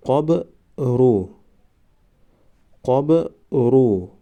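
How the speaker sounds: قبرو stretched very slowly, syllable by syllable; drawn-out demo. Shown as a demonstration. Teacher modelling the word for students, very slow. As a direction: draw out قبرو slowly for the class. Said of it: "ما باید همان حرف را فقط آوازش را تلفظ کنیم".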